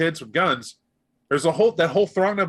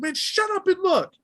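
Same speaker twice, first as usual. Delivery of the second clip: very high, shouting over; rally the others; high yelling kid; calling the crew. Acted. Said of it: "start this argument".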